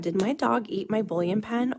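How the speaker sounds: ordinary talk, nothing marked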